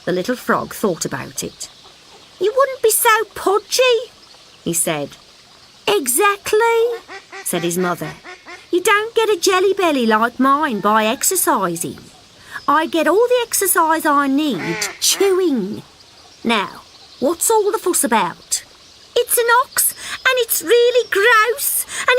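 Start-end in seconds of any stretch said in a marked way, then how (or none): 2.4-4.0 high wheedling little frog; pleading little frog. Acted. Frog cheeky. 5.9-18.6 high mother voice, mildly scolding; scolding mother frog. Acted.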